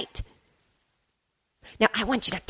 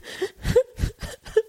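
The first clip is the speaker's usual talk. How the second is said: crying; 12 semitones higher than usual. Acted. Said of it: "why are you crying".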